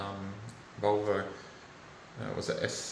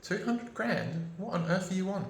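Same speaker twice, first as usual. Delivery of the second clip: as if asking the government if it's mad; incredulous scoff; 10 semitones higher than usual. Real, not acted. Ranting at the government, high incredulous scoff. Mocking the huge NDIS budget.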